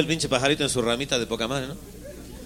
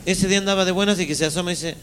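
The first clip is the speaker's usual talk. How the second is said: ordinary talk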